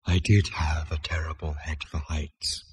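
slow, low, whispery ghost voice; hushed, regretful spirit murmur; wistful. A narrator's reading. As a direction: add it wistfully, soft and fading. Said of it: "it added wistfully".

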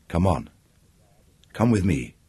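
soft, gentle coaxing. A narrator's reading. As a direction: coax him along softly. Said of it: "she coaxed him".

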